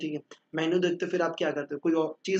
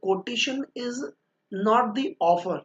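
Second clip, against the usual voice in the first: slow dictation; 5 semitones higher, much slower than usual. Shown as a demonstration. Dictating the definition from the book.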